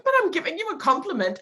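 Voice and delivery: high, quick protest; high-pitched self-defence; protesting compliment. Retold or imitated. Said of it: "It sounds like I'm not even giving".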